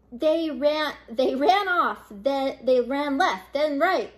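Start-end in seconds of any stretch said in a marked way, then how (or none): none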